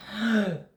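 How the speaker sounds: sharp gasp. Shown as a demonstration. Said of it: "and they do this".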